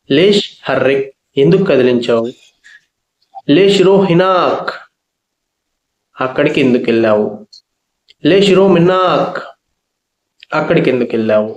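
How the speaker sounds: ordinary talk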